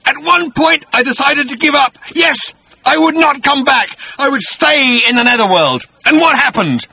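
high, loud brooding monster voice; a beast nursing his grudge; vengeful bat-creature. Acted. Agrajag recounting his return.

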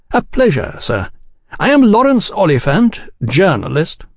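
high, cordial gentleman's greeting. A narrator's reading. Oliphant introducing himself to suspicious Tate, cordial. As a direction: introduce yourself with effusive courtesy. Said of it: "confused by Oliphant's cordiality".

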